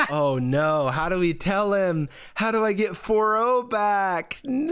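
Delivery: theatrical distraught "no"; mock panic. Mock panic over lost chatbot.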